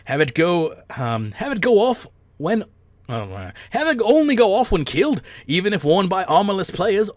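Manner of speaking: very high, loud, hammed-up fake Irish; mock-Irish lilt swooping high, neither Irish nor Scottish; bad Irish accent. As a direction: plough on through a failing Irish-Scottish accent. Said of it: "regardless of it neither being Irish nor Scottish".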